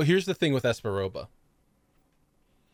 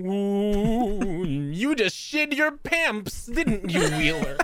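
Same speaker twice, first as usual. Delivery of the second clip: higher, slow taunting voice; mock Esperoba taunt; 5 semitones higher, much slower than usual. Player voices Esperoba taunting Wheeler, high and slow. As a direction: gloat over Wheeler slowly. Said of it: "Esperoba standing there going".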